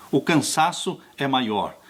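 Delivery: ordinary delivery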